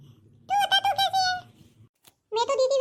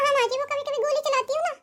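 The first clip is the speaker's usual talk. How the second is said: normal talking voice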